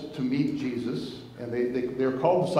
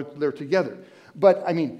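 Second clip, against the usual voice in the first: ordinary talk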